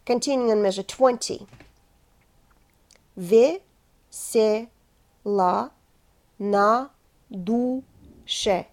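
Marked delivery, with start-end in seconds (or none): 3.2-8.7 Ve-se-la slowly split into separate syllables; медленно по слогам. Shown as a demonstration. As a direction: spell it out slowly, one syllable at a time. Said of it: "I will say each word syllable by syllable".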